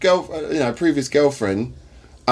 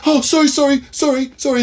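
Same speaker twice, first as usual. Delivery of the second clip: much higher apologetic cabbie voice; sheepish cabbie; 13 semitones higher, 4 dB louder than usual. Retold or imitated. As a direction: play the caught-out driver mumbling sorry. Cab driver apologising in story.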